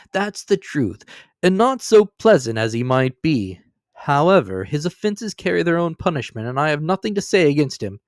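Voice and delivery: lightly voiced jolly young man; cheerful nephew. A narrator's reading. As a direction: speak up kindly for the old man.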